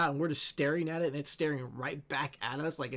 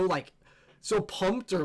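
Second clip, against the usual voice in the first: usual speaking voice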